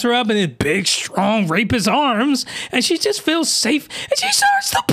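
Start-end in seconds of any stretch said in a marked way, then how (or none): 4.0-4.8 high squeal leading into the word; squealing setup. Real, not acted. Mocking the girl who starts to blush, high squeal. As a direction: squeak it up high, then drop.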